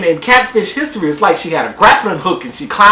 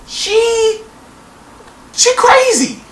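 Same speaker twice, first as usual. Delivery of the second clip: very high-pitched comic disbelief; incredulous squeak; 14 semitones higher, much slower than usual. Real, not acted. Comic emphasis on her craziness.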